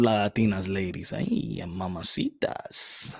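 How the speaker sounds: soft, slow, breathy ay mamacitas; flirty aside. Acted. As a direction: purr it out slowly like a playful flirt.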